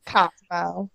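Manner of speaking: soft rising Southern "Cosmo" with a long vowel; Southern accent. Shown as a demonstration. Host repeats the requested word, soft Southern accent. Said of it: "Cosmo in a Southern accent?".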